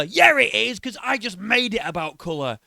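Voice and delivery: loud, high parody of arguing back; mock insistence. Reactor playing the boxer making it about colour, mocking. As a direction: argue back in mock indignation.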